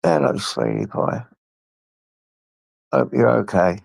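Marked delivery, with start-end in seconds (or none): 0.0-1.2 much lower, gruff playful greeting to the dog; low jokey hello to the dog; deep pet voice. Real, not acted. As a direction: drop the voice way down for a silly dog greeting.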